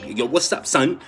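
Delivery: loud mock New York greeting; mocking New York slang. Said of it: "Stop saying that every New Yorker".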